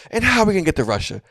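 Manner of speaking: rising irritated retort, quick; annoyed. Retold or imitated. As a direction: snap back, fed up with her. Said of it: "you can hear him getting annoyed".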